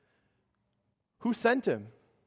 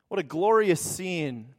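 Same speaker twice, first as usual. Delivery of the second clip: ordinary delivery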